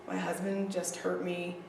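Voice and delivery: very flat. Retold or imitated. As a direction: say it flat as if reporting the weather, no feeling. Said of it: "like, very flat".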